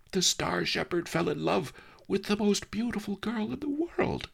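lightly voiced young girl, high and protesting; a peasant girl insisting on her point; mildly voiced girl, indignant and high; indignant Lotus Cloud.

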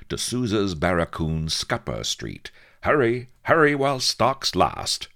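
brisk-voiced small-ad pitch, each item set apart; advert read. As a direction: announce the sale item by item, building to an urgent finish. Reads a sale advert.

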